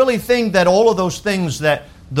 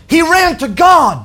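higher, louder ringing cry; preached shout; 10 semitones higher, 5 dB louder, slower than usual. Acted. Pastor to congregation, shouted proclamation. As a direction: proclaim it out loud.

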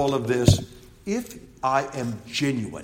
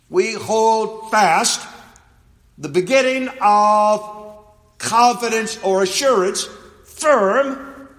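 slow, loud, raised-pitch preaching; emphatic scripture reading; 8 semitones higher, 5 dB louder, slower than usual. Acted. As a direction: read it word by word, weighing each. Reads scripture aloud emphatically.

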